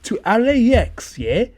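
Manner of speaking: hammed-up Cockney tough talk; mock Cockney threat. Co-host as Cockney listener out to fight, hammed-up Cockney. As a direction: threaten in a put-on Cockney accent. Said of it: "if there was a Cockney person out there".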